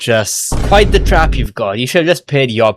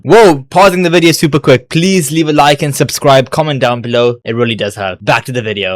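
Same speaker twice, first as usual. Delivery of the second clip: bright, louder ad read; channel plug; 4 semitones higher, 4 dB louder than usual. Acted. The host asks viewers to like and subscribe, upbeat. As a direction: pitch the subscribe ask.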